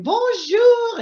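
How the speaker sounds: put-on high, loud sing-out in a French accent; crazy French accent. Daughter imitating her mom greeting customers, crazy French accent. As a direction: trill a big French greeting, imitating an exuberant hostess. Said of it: "this crazy French accent going".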